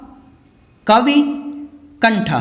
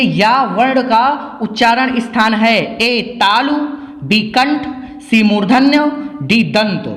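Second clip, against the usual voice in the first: slow it down so students catch it; slow and clear. Shown as a demonstration. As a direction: read the question slowly, word by word. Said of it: "ध्यान से देखिए". (धीरे और साफ़ पढ़ा हुआ प्रश्न)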